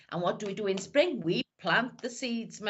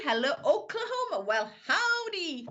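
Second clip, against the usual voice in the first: mock American accent; 5 semitones higher than usual. Greeting a viewer from Oklahoma, mock American accent. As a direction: stretch it out in a playful American accent. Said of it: "Don't ask me to go any further with the accent".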